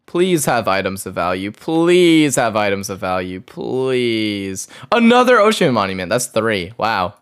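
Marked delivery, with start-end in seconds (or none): 0.1-4.7 low, drawn-out begging; pleading. Real, not acted. Gamer begging for items of value, drawn-out pleading. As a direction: lean on each please and stretch it out. Begs for valuable loot.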